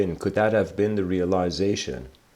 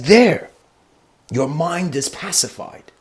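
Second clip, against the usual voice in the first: higher, louder burst on There; sudden loud declaration; 6 semitones higher, 5 dB louder, slower than usual. Retold or imitated. Bodhidharma answering the freaking-out man, loud declaration. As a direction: declare it like a thunderclap.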